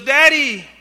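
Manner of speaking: put-on girl's cry for her father from the burning house; retold yell. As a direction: call out high and loud. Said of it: "she yells".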